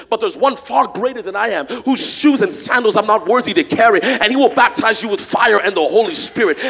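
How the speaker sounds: loud, rising preached quote; preaching. Acted. Pastor preaching John's words to the church, loud. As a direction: proclaim it like a prophet.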